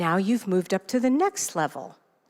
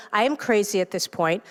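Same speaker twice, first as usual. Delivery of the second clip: ordinary talk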